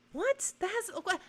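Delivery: high, rising disbelief; incredulous what. Retold or imitated. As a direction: squeak out a stunned what. Stunned reply to absurd claim.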